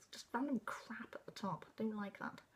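breathy whispered complaint; whispered grumble. Real, not acted. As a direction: grumble it under your breath. Annoyed aside at the book.

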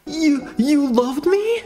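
high, slow, halting question; stunned disbelief. Acted. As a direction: stammer it high in acted disbelief. Nick's stunned realisation in story.

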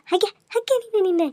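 high-pitched cooing; baby talk. Acted. Soothing a crying baby with high cooing baby talk.

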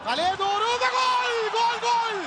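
high shouted goal call; screaming football commentator. Real, not acted. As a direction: scream the goal at full pitch. Goal scored, commentator erupts.

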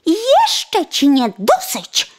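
high, loud, slow gloating call; sparrow's cry. Acted. Sparrow taunting the coachman, high and loud. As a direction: taunt him from above. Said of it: "zawołał wróbel".

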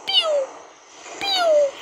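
high squeaky pew; high mock laser pew; laser noise. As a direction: zap like a toy blaster. Imitating laser shots.

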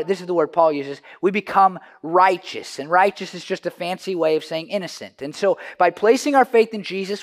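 loud, driving pulpit delivery; full-force preaching. Acted. Pastor preaching to his congregation, loud and driving. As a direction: preach it out to the whole room.

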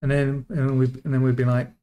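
usual speaking voice

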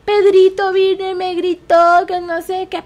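put-on tattle to mom like a kid; tattling child. (niño acusando a su hermano, más agudo y fuerte)

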